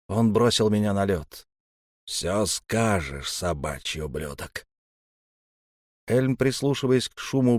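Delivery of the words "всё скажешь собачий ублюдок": slow, low, menacing; slow menacing threat. Acted. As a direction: drag out the threat. Elm threatens captive.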